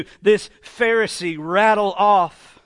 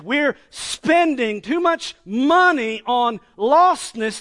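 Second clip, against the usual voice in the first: mock complaint; 5 semitones higher, 6 dB louder, slower than usual. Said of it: "they don't understand the heart of God".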